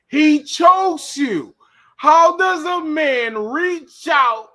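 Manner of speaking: slow mock disbelief; slow incredulous. Real, not acted. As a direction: stretch it out, stunned. Mocking the choke.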